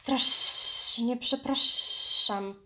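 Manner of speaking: very slow, loud hiss with drawn-out sibilants; hissing snake. A narrator's reading. Python apologizing to the panther, hissing. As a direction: stretch every s and sz like a snake. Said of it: "zasyczał".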